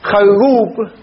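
خروب said kharrub with a long held r; doubled r. Shown as a demonstration. Teacher to students showing the doubled r in خروب. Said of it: "أو يشد راؤه".

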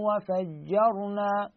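وفجرنا drawn out slowly with a full, heavy ر; tajweed demo of وفجرنا with a heavy ر. Shown as a demonstration. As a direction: stretch وفجرنا and make the ر heavy. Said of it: "هكذا".

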